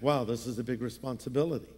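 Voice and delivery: fast, soft and awed; quick hushed wow; hushed awe. Retold or imitated. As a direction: say it half to yourself, overwhelmed. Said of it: "It's like".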